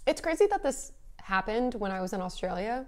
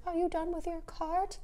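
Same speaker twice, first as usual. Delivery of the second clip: put-on old lady voice; 9 semitones higher, 6 dB quieter, slower than usual. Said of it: "she's like".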